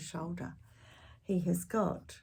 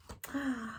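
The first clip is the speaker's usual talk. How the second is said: gasp; 5 semitones higher, 8 dB quieter than usual. Acted. Excited aside to children.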